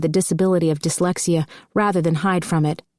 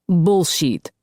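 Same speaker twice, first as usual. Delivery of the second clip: scoffing. A narrator's reading. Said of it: "Dmitri scoffed".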